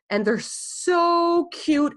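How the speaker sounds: high, slow, squealing gush; gushing over cute mugs. Real, not acted. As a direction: squeal it out, drawn-out and delighted. Showing off her new mugs.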